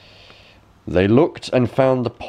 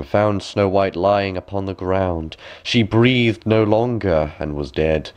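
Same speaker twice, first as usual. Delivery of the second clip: ordinary delivery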